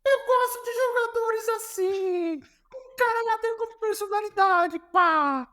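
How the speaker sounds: very high, emphatic imitation of the coach; Jorge Jesus declaring. Coach approves of player standing up to him, high emphatic impression. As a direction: declare it slowly in a high-pitched mock voice. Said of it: "Aí ele me olhou assim".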